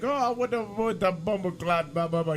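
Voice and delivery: high, annoyed Jamaican burst; a mock-angry Jamaican cleaner. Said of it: "getting pissed at him".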